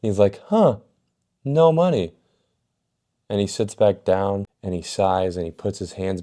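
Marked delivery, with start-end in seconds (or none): 0.5-2.1 much higher, sarcastic huh; sarcastic mock surprise. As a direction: fake surprise, dripping with sarcasm. Principal finding the money.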